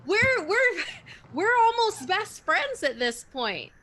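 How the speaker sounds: high, playful teasing voice; lightly voiced playful teasing; teasing friend. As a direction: tease her sweetly. Player voices Satya teasing.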